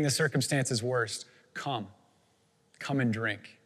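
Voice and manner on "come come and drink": soft, lowered, inviting; hushed call with room between the words; gentle invitation. Acted. Preacher invites the lost to drink, softly and gently.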